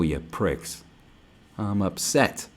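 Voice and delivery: ordinary delivery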